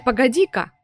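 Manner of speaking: usual speaking voice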